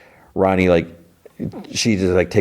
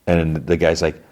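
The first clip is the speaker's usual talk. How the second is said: usual speaking voice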